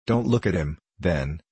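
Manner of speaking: usual speaking voice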